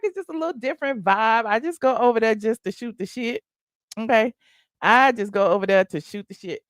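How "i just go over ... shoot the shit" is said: ordinary talk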